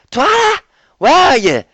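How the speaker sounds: loud high call; shouting. A narrator's reading. Applejack calls for Twilight in darkness, loud shout. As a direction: holler for Twilight.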